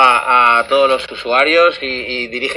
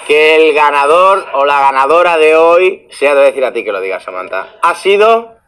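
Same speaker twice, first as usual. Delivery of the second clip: TV announcer; 2 semitones higher, 4 dB louder than usual. Acted. Announcing the winner on stream.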